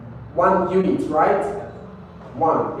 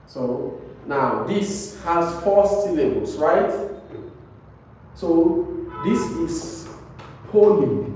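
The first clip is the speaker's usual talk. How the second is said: normal talking voice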